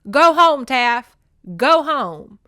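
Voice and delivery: yelling at the goat to go home. Retold or imitated. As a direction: shout the goat home down the road. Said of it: "I'm yelling in my overalls".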